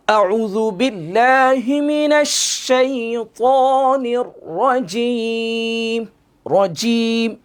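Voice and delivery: slow melodic Quranic chant; Quran recitation. Shown as a demonstration. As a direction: recite it slowly, drawing out each vowel. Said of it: "recitation demo of the Arabic phrase".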